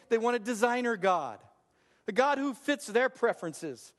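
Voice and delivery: ordinary talk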